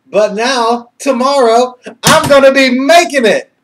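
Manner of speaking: high, loud, joyful shout; triumphant. Real, not acted. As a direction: crow it out in real triumph. Triumphant announcement, laughing.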